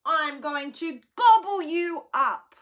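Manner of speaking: loud, high menacing troll. Acted. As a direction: threaten to gobble them up.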